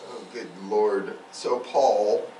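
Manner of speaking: ordinary talk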